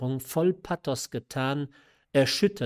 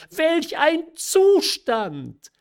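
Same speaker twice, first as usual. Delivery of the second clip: high, loud, drawn-out exclamation; incredulous cry; 8 dB louder, much slower than usual. A narrator's reading. Mother scorning her daughter's mysterious condition, incredulous cry. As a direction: wail it out slowly in exasperation. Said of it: "rief die Mutter wieder".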